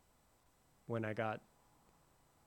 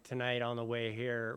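ordinary talk

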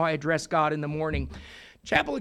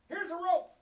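much higher, light helper voice; rescuer; 11 semitones higher, 4 dB quieter than usual. Acted. A stranger calls down to the man on the cliff, high. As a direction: offer it up brightly.

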